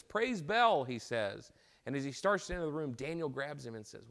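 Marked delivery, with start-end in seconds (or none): 0.0-0.8 high, loud joyful cry; rejoicing. Acted. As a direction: cheer it out like a triumphant king. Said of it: "And he rejoices".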